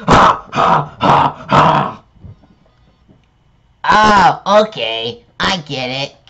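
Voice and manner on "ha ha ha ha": evil laugh. Pretend.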